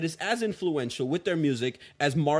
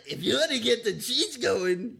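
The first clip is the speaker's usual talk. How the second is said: crackly voice; 6 semitones higher than usual. Shown as a demonstration. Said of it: "get your voice all crackly".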